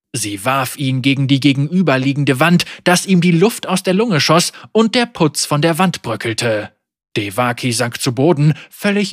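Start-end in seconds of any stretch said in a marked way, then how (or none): none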